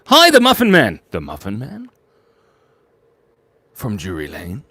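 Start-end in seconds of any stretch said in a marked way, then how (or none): 0.1-1.0 loud, high-pitched hail; excited greeting. Real, not acted. 1.1-1.9 softer, questioning tone; quiet mock inquiry.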